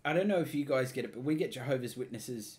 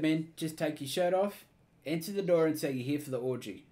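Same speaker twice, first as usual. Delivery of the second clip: ordinary delivery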